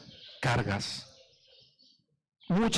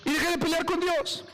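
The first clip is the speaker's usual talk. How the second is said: high-pitched, emphatic testimony; fervent declaration; 12 semitones higher than usual. Real, not acted. Preacher testifies he stopped fighting God, fervent. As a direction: declare it with conviction, pitched right up.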